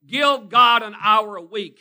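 slow, very loud, every word pressed; loud slow plea. Real, not acted. Preacher urging the church to give an hour, loud. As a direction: plead with them at full volume.

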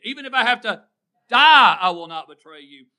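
mean every word, shout the promise; emphatic vow. Shown as a demonstration. Peter vowing to Jesus to die for him, loud and emphatic. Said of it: "say it like you mean it".

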